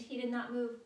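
high, loud scream. A narrator's reading. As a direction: scream it out in panic. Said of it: "Sam screamed".

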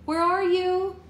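high, loud searching call; searching the dark forest, calling out loud. A narrator's reading. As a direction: shout her name into the trees. Searching dark woods for friend.

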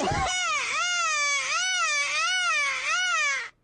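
long, high, cry-like wheeze of laughter; helpless high cackle; high wheezing laugh. Real, not acted. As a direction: lose it completely in a squealing laugh. Laughs at the video.

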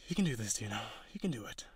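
hushed self-encouragement before singing; whispered pep talk. Acted. Harpy whispering courage to herself before singing. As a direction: whisper to yourself, steadying your nerve.

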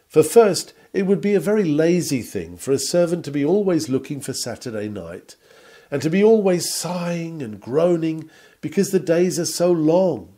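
ordinary talk

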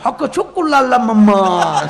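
slow, loud, laughing put-on foreign chant; hammed-up call of Jambo through laughter; mock African greeting. Preacher mimics visitors from Africa, loud and laughing. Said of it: "આફ્રિકાના આવેલા".

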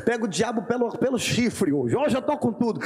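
lightly voiced boastful believer, low and cocky; a hint of a swaggering churchgoer. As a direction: brag like someone who feels unstoppable. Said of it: "a gente acorda inspirado e diz".

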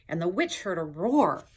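plain reading voice

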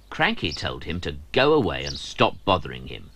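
ordinary delivery

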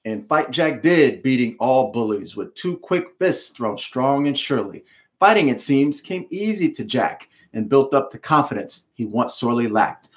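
plain reading voice

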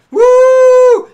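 high, loud whoop; cheer. Real, not acted. Streamer cheering that spirit heard them, loud whoop. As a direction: whoop with joy.

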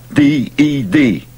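letters D E D spelled slowly. Acted. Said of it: "spells dead".

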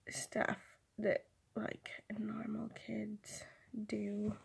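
soft unvoiced whispered aside. Real, not acted. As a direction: whisper it like a little confession. Aside about being childish.

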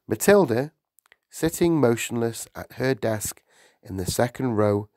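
ordinary delivery

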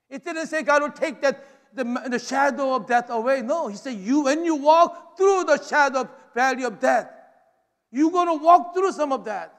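loud, driving sermon delivery; impassioned preaching. Real, not acted. Preacher assuring the congregation God walks through valleys with them, impassioned. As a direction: press each promise home forcefully.

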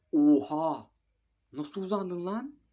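can't believe that save; amazed. Real, not acted. Gamer stunned by the keeper's stretch, high amazed disbelief.

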